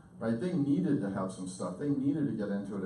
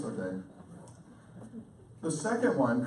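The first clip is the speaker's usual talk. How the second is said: ordinary talk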